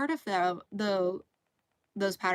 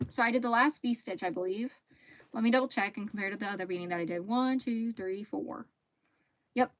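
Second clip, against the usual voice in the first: ordinary delivery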